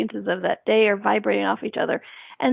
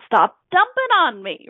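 higher, louder, slow plea to stop; mock protest; 7 semitones higher, 5 dB louder than usual. Victim pushing back at people dumping pity, mock protest. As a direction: push the pity back off you. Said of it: "I'm like".

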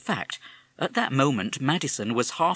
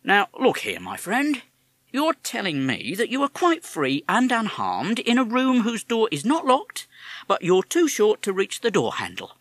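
policeman; 10 semitones higher, 6 dB louder than usual. Acted. Policeman grows impatient.

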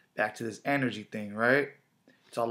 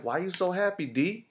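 lightly imitated questioning, pitched up high; mock puzzled coworker; 7 semitones higher than usual. Coworkers asking why he's happy on Monday, mock puzzled. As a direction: ask it higher, like a baffled coworker.

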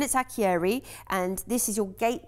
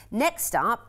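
usual speaking voice

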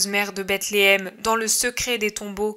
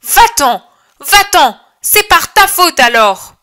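high, loud furious shout; furious cry; 7 semitones higher, 10 dB louder than usual. Acted. Angry villager shouting Jesus out of town, high furious yell. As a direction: drive him away in rage.

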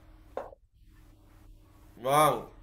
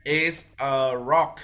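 slow model phrase; slower than usual. Shown as a demonstration. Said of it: "Con đọc đi ạ".

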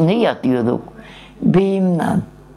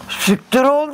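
retold curse; 10 semitones higher than usual. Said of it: "dedi meşe oğlu eşek".

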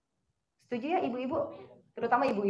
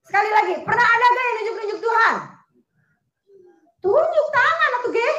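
calling out; 10 semitones higher, 11 dB louder than usual. Acted. Preacher calling out to congregation.

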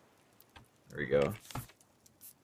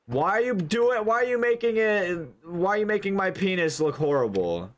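exasperated; 9 semitones higher than usual. Real, not acted. Exasperated at game building.

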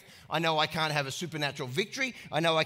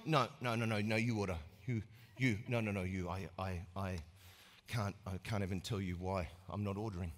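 very low, quiet, flustered mumble; awkward fasting guest; 10 semitones lower, 11 dB quieter than usual. Acted. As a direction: squirm out of ordering lunch. Awkward faster at lunch.

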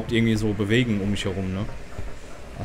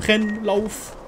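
panicked urging; 9 semitones higher than usual. Real, not acted. Fleeing in the game.

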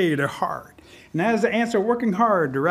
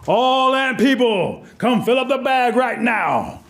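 slow, high, raised command call; queen ant's summons; 4 semitones higher, much slower than usual. A narrator's reading. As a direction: call out to a crowd, stretching the words. Queen ant summons her people.

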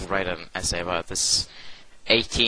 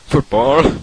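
Football with a rising, higher, louder British lilt; mock British Football; 6 semitones higher, 10 dB louder than usual. Shown as a demonstration. Podcaster jokingly says Football the British way. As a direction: say Football like a Brit would, rising at the end. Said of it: "as I say, football here".

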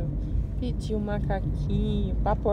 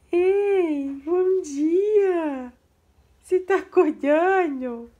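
excited greeting; 5 semitones higher, 6 dB louder, much slower than usual. Real, not acted. Mãe cumprimenta bebê animada. (mãe cumprimentando o bebê, aguda e alta)

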